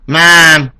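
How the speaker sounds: loud call of mom; kid calling out. Retold or imitated. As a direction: interrupt her with a loud call. Kid interrupting mom on phone.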